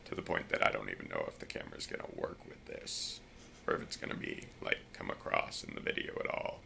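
very low, soft voice; dropped deep voice. Shown as a demonstration. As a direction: drop the voice as low as it goes. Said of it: "I can just drop my voice".

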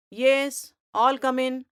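loud, curt teacher's call. Acted. Teacher calls the late boys in, loudly. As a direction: bark the welcome across the room.

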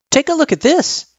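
put-on high, light child character voice; little kid voice, pitched well up; Frisk, eager kid. Frisk showing Sans a photo, high kid voice.

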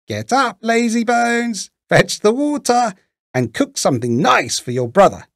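put-on high-pitched witch ordering a child about; bossy witch. A narrator's reading. Witch rousing Gretel in story.

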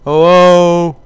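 loud, high call into the darkness. Real, not acted. Player calls into the dark building, loud uneasy call. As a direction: shout hello into the empty dark. Calling into dark game hallway.

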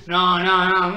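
ordinary talk, nothing marked

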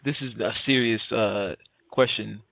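ordinary talk

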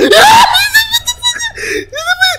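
shrieking laugh of pure panic; high screaming laugh as the monster catches up; cackling scream, terrified; panicked laugh-scream. Real, not acted. Panicked shriek while chased.

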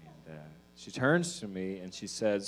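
ordinary delivery

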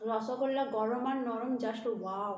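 chewing while talking; talking between bites; mouth full. Real, not acted. Seen 0: bites rasgulla before; cheeks full, jaw working while speaking.

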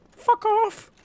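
very high squeaked outburst; sudden falsetto yell; yelled dismissal. Real, not acted. Yelling at game enemy.

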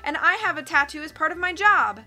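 crying out. A narrator's reading. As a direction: defend yourself to a hostile crowd. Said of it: "Jack cried".

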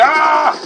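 high, drawn-out scream; loud scream. Real, not acted. Gamer charging in for a kill, loud scream. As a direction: scream out mid-attack.

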